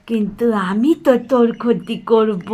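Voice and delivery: normal talking voice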